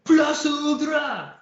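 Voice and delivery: high, loud, long-held hero yell; soaring, drawn-out rallying cry; anime battle cry. Acted. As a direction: shout it out with every syllable stretched.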